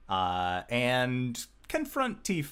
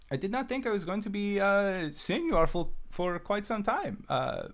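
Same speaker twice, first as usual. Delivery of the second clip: much higher, fast, cocky boss greeting; Tifa voice; 10 semitones higher than usual. Acted. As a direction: lounge back and greet them in a much higher voice.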